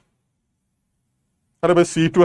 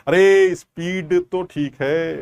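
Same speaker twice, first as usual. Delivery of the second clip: drawn-out, exaggerated slow reply; playfully slowed down; much slower than usual. Shown as a demonstration. Teacher answering a student's plea to slow down, playfully slowed. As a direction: stretch every word in mock slow motion.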